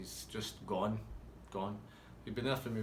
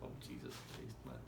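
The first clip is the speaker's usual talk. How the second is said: hushed, breathy disbelief under the breath; whispering in shock at the stoned barman; whispered aside; 12 dB quieter than usual. Retold or imitated. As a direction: mutter it so nobody hears. Stunned aside watching him.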